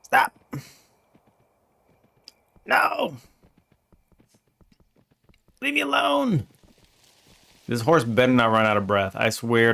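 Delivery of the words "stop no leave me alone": much higher mock-outraged squeal; mock falsetto scolding at the game character; squeaky falsetto frustration. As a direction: squeal it at the greedy little merchant. Yelling at game merchant.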